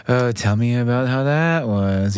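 slow, put-on show of interest; mock someone only pretending to care; feigned interest. Speaker shows listeners how not to ask, feigned interest. Mock example of uncaring asker.

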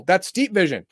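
normal talking voice

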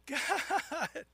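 high, strained call for help; mock gurgling cry to God. Man floating in a lake calls God, high mock cry. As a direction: call out as if floundering in water.

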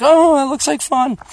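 high, put-on wistful voice; mock childish yearning; imitating longing child. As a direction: pine for it like a kid left out. Acts out wistful kid passing dunes.

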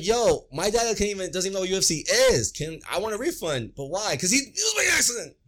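telling support it was an accident, exasperated; heated complaint. Retold or imitated. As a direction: push it up high, pleading and frustrated.

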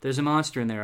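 ordinary delivery

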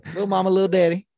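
Southern twang. Retold or imitated. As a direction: rattle it off in her twang. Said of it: "with that twang".

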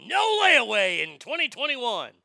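mocking Walmart's harsh announcement; mock Walmart decree. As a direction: bark it out like a corporate notice. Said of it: "racist ass Walmart is saying".

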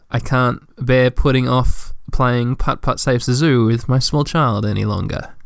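ordinary delivery

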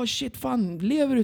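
high, hushed-down mimicry of worried locals; alarmed onlookers. Locals checking the fallen rider is alive, high alarmed imitation. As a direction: imitate a stunned bystander, pitched high.

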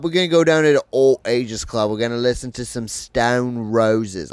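put-on mates asked for an English accent, he does a rowdy Manchester pub bloke. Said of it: "Can you do your best [name]?".

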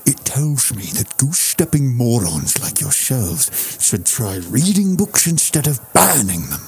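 put-on stonewalling Connery, Scottish accent, measured; Sean Connery voice. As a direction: answer with dry Scottish scorn. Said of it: "my Sean Connery".

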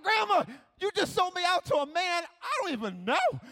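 put-on high-pitched child's protest; outraged disbelief. Child to Grandma who sold him out, outraged. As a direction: squeal in betrayed disbelief.

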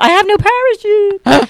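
high, loud, drawn-out wail; mock panicked cry. Acting out being pushed from plane.

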